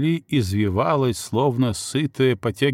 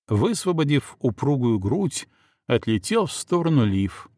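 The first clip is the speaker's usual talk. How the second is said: plain reading voice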